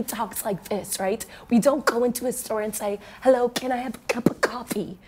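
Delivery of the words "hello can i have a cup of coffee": every consonant in Hello, can I have a cup of coffee crisply hit; mock overly precise speech; over-articulated. Shown as a demonstration. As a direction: exaggerate each letter of the words. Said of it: "we don't articulate our letters like that".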